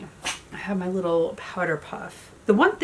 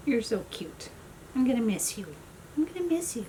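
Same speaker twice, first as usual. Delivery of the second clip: soft, high cooing; tender pet talk; 5 semitones higher, 7 dB quieter than usual. Real, not acted. Owner telling her dog she'll miss him, tender pet talk.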